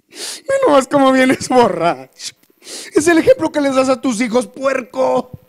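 grito agudo y lento de esposa furiosa; regáñalo muy agudo y fuerte; mujer enojada. Acted. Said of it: "imagínate cómo estaría de enojada". (esposa furiosa al marido borracho en la puerta, aguda y fuerte)

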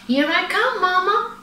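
high-pitched playful holler; excited little panda calling out; hollering cub. A narrator's reading. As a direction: call out high to Mama while chasing her. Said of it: "Bao Bao hollered as he ran".

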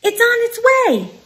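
high, bright little bird voice; robin voice. Acted. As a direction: chirp it like a tiny robin. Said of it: "he says".

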